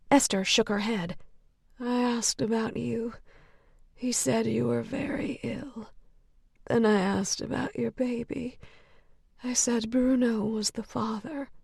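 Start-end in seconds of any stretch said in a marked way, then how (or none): 1.8-11.5 soft unvoiced confiding whisper. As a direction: confide it under your breath. Whispering patient confiding secrets.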